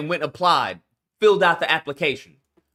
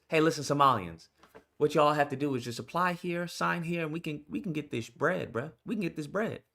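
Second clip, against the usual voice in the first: 1 sly hushed pitch; 4 semitones lower, 10 dB quieter than usual. Retold or imitated. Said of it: "ringleader of this".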